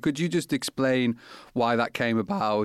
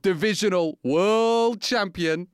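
lifted, punchy podcast intro read; announcer intro; 6 semitones higher than usual. Acted. Host introducing the guest in an announcer read. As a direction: sell the champion to the listeners.

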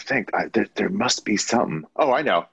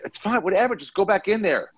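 much higher, tossed-off mock bouncer; dismissive bouncer; 12 semitones higher than usual. Bouncer waving off the apologetic guy, high and dismissive. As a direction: wave it away, can't be bothered. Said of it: "he's like".

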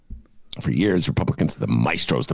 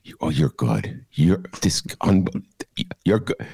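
De Niro impression; 4 semitones lower than usual. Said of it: "I'm De Niro".

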